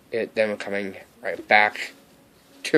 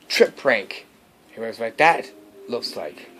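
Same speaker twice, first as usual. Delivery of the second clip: ordinary talk, nothing marked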